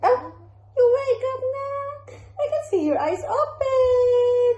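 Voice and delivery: very high, drawn-out squealing coo; gleeful high sing-song mommy voice. Real, not acted. Mother greets waking baby.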